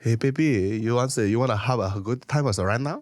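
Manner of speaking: come-on in a put-on Korean accent. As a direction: lay on a mock Korean accent to flirt. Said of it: "Korean accent?".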